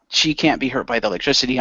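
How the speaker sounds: usual speaking voice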